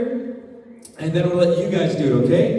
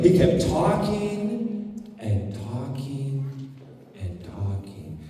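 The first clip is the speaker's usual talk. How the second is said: slow, sinking lower and softer; 8 semitones lower, 6 dB quieter, much slower than usual. Shown as a demonstration. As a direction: drag it down until the room goes quiet. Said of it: "as I lower my voice".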